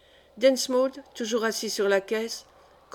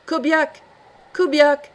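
shouted higher and louder, calling into the wind; 5 semitones higher, 7 dB louder, slower than usual. Acted. Walens calling for the missing Kobiak into the storm, shouting. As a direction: call out for a lost man, louder the second time. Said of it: "appela-t-il encore".

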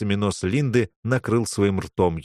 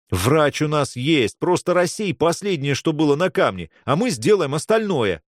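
higher, forceful insistence; insistent urging; 6 semitones higher than usual. Acted. Boy urging the mage to clear the stone, insistent urging. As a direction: press the mage to do his part.

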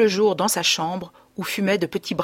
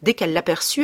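plain reading voice

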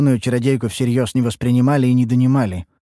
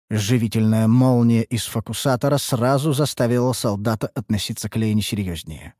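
usual speaking voice

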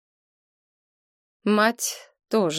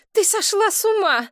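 much higher outraged cry; indignant interruption; 14 semitones higher, 4 dB louder than usual. A narrator's reading. Mother cutting off her daughter about the dog, outraged. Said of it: "перебила её мать".

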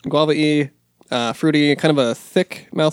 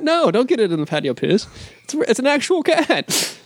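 protesting; 8 semitones higher than usual. Real, not acted. Beer taster objecting to co-host's patio joke, high protest. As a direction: object with rising exasperation, stretching the end.

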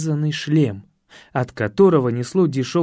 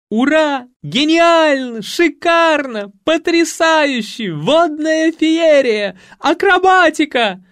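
high, loud, slow ecstatic cheering; восхищение зрителей; 12 semitones higher, 6 dB louder, slower than usual. Acted. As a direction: cheer each word like an enraptured audience. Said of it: "восхищались зрители". (громко и протяжно восторгаться)

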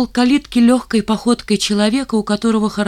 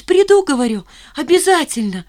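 радостное согласие, высоко и громко; соглашайся сразу, с восторгом; обрадованно; 7 semitones higher, 5 dB louder than usual. A narrator's reading. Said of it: "А я обрадовалась". (радостно и звонко)

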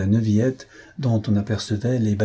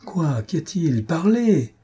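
urgent demand; 7 semitones higher, slower than usual. A narrator's reading. Lupin demanding news from Césarine, alarmed and rising. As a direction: press her for news, alarmed. Lupin rushes to the panting woman.

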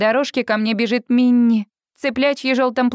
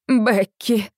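voice breaking. Retold or imitated. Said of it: "Мамин голос срывается".

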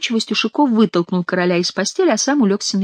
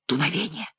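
barely voiced soft answer; soft, hushed word drifting upward; very quiet; 10 dB quieter than usual. A narrator's reading. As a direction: answer in a hush. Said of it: "ещё тихо ответил король".

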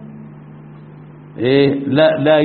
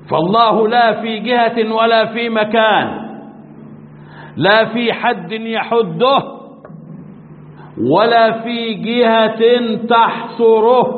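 slow, high, every لا stressed; emphatic creed statement; 6 semitones higher, slower than usual. Real, not acted. Sheikh stresses to his students that God has no place, slow and emphatic. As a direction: preach each لا firmly.

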